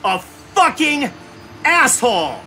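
slow, loud, emphatic curse; angry cursing. Real, not acted. Gamer cursing the boss that beat him, angry shout. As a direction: spit out each word loudly, drawn out.

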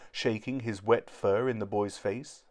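ordinary delivery

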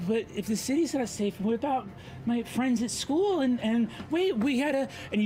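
put-on a boy panicking about his school friends; upset boy. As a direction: son pleads with his father about leaving the city, high and upset. GM voices Kier, upset.

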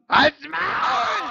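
sudden high yell at the screen; angry shout. Real, not acted. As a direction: snap it out at full voice. Raging at game opponent.

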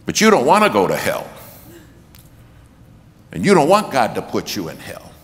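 preacher pleading with the crowd at full volume; rising preacher's shout. Retold or imitated. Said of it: "he keeps raising his voice".

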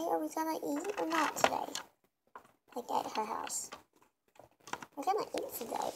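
ordinary delivery

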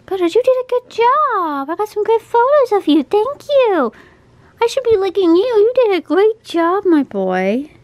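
high, loud gushing praise. Real, not acted. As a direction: gush over the cat. Gushing at cat.

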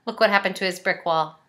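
plain reading voice

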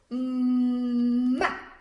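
long held "mm" opening into "ma"; sílaba ma. Shown as a demonstration. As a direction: stretch the hummed "mm", then release it as "ma". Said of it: "La M con la A dice". ("mm" alargado que se abre en "ma")

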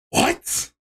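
breathless whispered what; stunned what. Retold or imitated. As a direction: let the disbelief knock the voice out. Outraged at manager's logic.

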